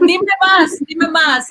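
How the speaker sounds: eager excitement. Real, not acted. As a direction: urge them on, thrilled. Eagerly asking for more.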